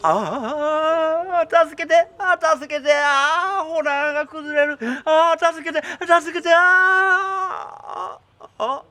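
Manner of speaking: high, frightened calls for help; panicked cries. Acted. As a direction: scream for help as the cave falls. Cave collapsing, calling for help.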